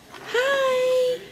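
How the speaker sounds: squeaky high little hi; high baby voice. Acted. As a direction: pitch it way up like a toddler. Greeting great granny, baby voice.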